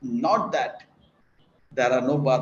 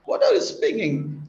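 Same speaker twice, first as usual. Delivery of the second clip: incredulous; 9 semitones higher than usual. Acted. Barber baffled by the claim.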